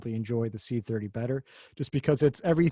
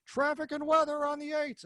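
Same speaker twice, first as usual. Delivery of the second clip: high, mock radio announcer voice, much higher; radio traffic announcer; 13 semitones higher, 3 dB quieter than usual. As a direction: ham up a traffic-and-weather DJ. Mimicking a blaring car radio.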